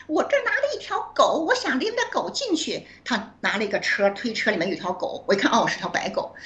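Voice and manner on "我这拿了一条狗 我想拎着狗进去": imitating a classmate's piercing voice, pitched much higher; high-octave voice. Old classmate at the hotel door explaining her dog, in a much higher mock voice. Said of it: "那个高八度的声音".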